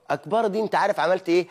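ordinary talk, nothing marked

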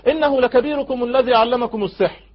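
high-pitched recited accusation; Pharaoh's accusation. Acted. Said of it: "ففرعون قال". (اتهام بنبرة عالية)

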